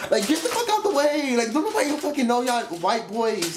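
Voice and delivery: high-pitched disbelieving rant; incredulous rant. Retold or imitated. Vlogger ranting about the fake brawl, high and incredulous.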